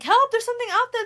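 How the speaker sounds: high, loud and fast panicked cry for help; fake alarm. Lily tricking Tom outside, loud fake panicked cry. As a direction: fake a frantic scream for help.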